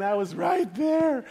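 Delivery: high, slow, drawn-out protest; exasperated insistence. Retold or imitated. Dad to crying daughter, exasperated insistence. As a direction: insist word by word, mock exasperated.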